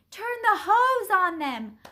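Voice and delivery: high, loud urgent order; shout. A narrator's reading. Bystander wants the dogs sprayed, shouting. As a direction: shout instructions over the commotion. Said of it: "someone shouted".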